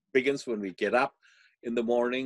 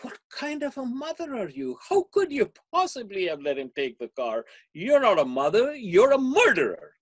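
much higher, louder shocked accusation; accusing friend; 13 semitones higher, 6 dB louder than usual. Retold or imitated. Friend on the phone accusing the mother, shrill outrage. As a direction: hurl it like a horrified accusation. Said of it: "And the friend says".

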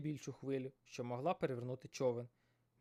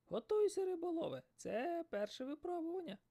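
shouted. Acted. Seagull shouting to the fisherman in the storm, high. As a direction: cry out over the storm. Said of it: "крикнула чайка".

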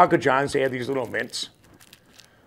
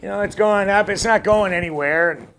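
usual speaking voice

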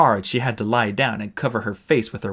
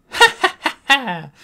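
loud, higher gloating mock laugh; villain laugh; 6 dB louder than usual. Clay gloating in the play, loud mock laugh. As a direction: laugh in triumph over the treasure.

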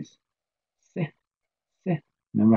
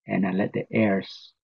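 normal talking voice

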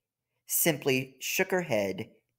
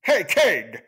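louder call, a bit higher; brash frog; 4 semitones higher, 6 dB louder than usual. Acted. The frog calls to the king, loud and brash. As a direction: barge in to get attention.

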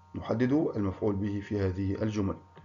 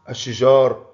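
loud answer; 12 dB louder, slower than usual. Real, not acted. Teacher gives the answer loudly.

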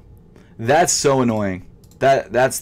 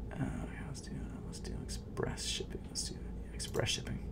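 whispered to self; barely voiced choice; 3 semitones higher, 20 dB quieter than usual. Real, not acted. Vlogger whispering checkout choices to himself while typing.

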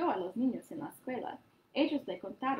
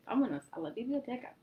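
ordinary delivery, nothing marked

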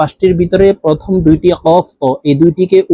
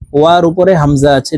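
ordinary talk, nothing marked

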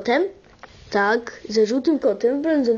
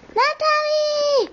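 high, long drawn-out call of a name; calling a friend to come over; 13 semitones higher, much slower than usual. Acted. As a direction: shout the name across the house slowly.

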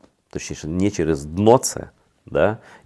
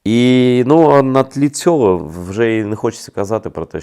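ordinary talk, nothing marked

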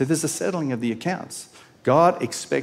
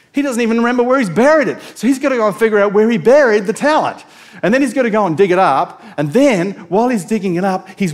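fast, loud, higher, urgent retelling; excited; 6 semitones higher, 7 dB louder, faster than usual. Real, not acted. Preacher to congregation, fast and loud. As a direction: rush it out, voice climbing.

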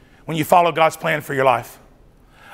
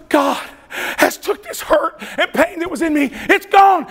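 high, laughing joy; retold overjoyed stranger; overjoyed testimony; 10 semitones higher than usual. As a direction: burst with relief. Man telling of his healing.

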